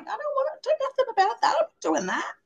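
very high, fast, whiny stubborn refusal; mock stubborn child. She refuses the universe's advice in a high whiny kid voice. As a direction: squeak it out like a sulky kid. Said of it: "And I'm like".

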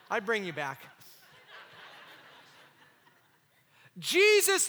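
loud, impassioned sermon delivery; full-force preaching. Acted. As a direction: preach it hard to the congregation.

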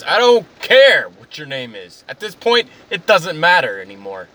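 loud, exasperated outburst; acted customer blowing up; irate customer. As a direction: cut the clerk off angrily, stretching "care". Acted quarrel with pizza clerk.

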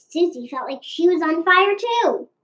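plain reading voice

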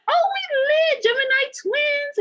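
put-on girl meeting a birthday twin at the club, excited cheer; excited quote. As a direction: celebrate finding a birthday twin. Excited birthday reply in retold chat.

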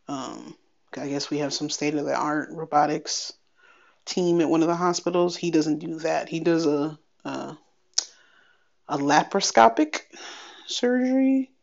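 ordinary talk

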